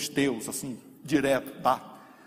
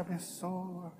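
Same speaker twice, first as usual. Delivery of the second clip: much softer, easing into relief; relieved sigh; 3 semitones higher, 9 dB quieter, slower than usual. Retold or imitated. Believer whose heart finally eases, soft and relieved. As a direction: let the heart unknot, gently. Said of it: "o coração alivia".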